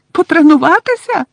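high, loud indignant elf squeak; angry squeak. Acted. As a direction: squeak it back in outrage. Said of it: "злісно запищала Вінкі". (злісний писк ельфійки)

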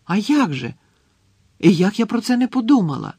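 high, loud astonished delight; stunned excitement. A narrator's reading. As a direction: exclaim it as a sudden revelation. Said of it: "просто очманіла Герміона". (високий, захоплений вигук)

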